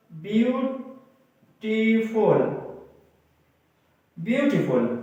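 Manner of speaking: "Beautiful" very slow, split with pauses; syllable by syllable. Shown as a demonstration. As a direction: draw out "beautiful" in separate parts. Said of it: "किसी लफ्ज को तोड़ें". (Beautiful को धीरे-धीरे तोड़कर)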